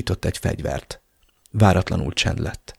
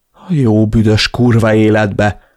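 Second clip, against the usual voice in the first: loud, slow, angry swearing; exasperated curse; 5 dB louder, slower than usual. A narrator's reading. Narrator reacts to sister's confession, cursing angrily. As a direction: curse it out, word by word.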